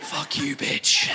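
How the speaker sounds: slow whispered curse; whispered insult. Acted. Cursing the waitress under his breath, slow whisper. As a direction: hiss it under the breath, slowly.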